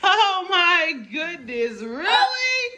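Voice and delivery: very high, loud shrieking laughter; giggling. Real, not acted. Tickle challenge, everyone cracking up.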